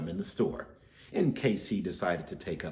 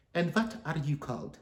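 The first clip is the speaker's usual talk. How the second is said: high sweet cooing voice; 7 semitones higher than usual. A narrator's reading. Neighbour Anna cooing sweetly at Stanley. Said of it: "she cooed".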